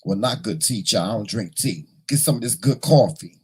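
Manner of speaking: ordinary delivery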